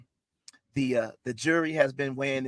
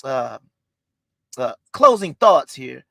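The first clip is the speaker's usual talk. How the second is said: slow announcement; 6 dB louder, much slower than usual. Acted. Announcing judge's ruling.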